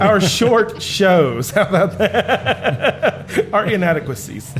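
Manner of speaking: slow words through a chuckle; laughing. Real, not acted. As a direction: drag it out while laughing. Joking reword, laughing through it.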